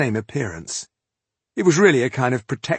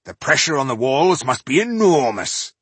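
raised, impressed exclamation; extravagant praise; 5 semitones higher, 5 dB louder than usual. A narrator's reading. Dwarf marvelling at the walls under water, extravagantly impressed. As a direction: marvel at it with a louder lift. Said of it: "was extravagant in his praise".

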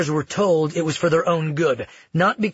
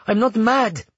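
higher-pitched denial; alarmed protest; 7 semitones higher, 3 dB louder than usual. Acted. Granddaughter denies madness to her grandmother, alarmed protest. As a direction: protest your sanity urgently.

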